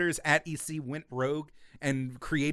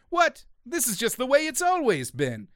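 mock baffled robot; 9 semitones higher than usual. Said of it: "the orange robots are like".

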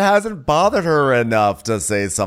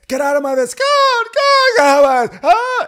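high, escalating shrieks; mock screaming match; 7 dB louder than usual. Co-host mimics housewives brawling, escalating mock screams. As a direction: build from yelling into a wild wail.

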